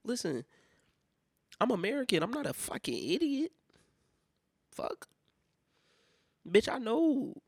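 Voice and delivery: high, hammed-up indignation; comic outrage, pitch leaping up; indignant retort. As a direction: protest like you've been taken for a fool. Continues acted retort to clerk.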